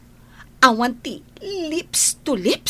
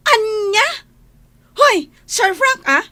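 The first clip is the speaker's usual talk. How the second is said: loud, crying out in disbelief; shocked outburst; 4 semitones higher, 8 dB louder, slower than usual. Acted. Angie learning of Hubert's love, loud shocked outcry. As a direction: blurt it out loudly, stunned.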